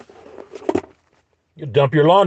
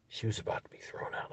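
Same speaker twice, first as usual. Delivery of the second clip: imitated hushed aside; barely audible, whispered; confiding whisper; 22 dB quieter than usual. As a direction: whisper it like a staff member leaking a secret. Retells worker's confidential remark.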